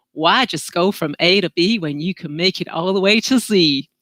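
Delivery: amateur American accent for a catchy mantra; attempted American accent. Shown as a demonstration. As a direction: have a go at a mock American accent. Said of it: "best said in American accents".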